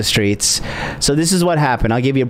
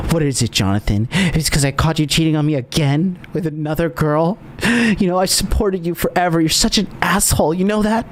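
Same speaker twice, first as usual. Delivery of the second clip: higher, rising hurt accusation; hammed-up hurt anger, pitched higher; mock upset girlfriend; 4 semitones higher than usual. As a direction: play the wronged girlfriend getting worked up. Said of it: "Spiking that emotion".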